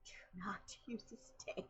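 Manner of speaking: hissed whispered warning; stern whisper. Retold or imitated. Warning the sisters off the stick, whispered. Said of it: "I'm like".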